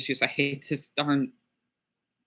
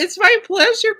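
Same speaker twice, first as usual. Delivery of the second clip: much higher and louder, "my" bouncing up; excited thanks; 10 dB louder than usual. Real, not acted. To the Russian Canadian stranger, a gushing excited thank-you. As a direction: gush "it's my pleasure" like a delighted fan.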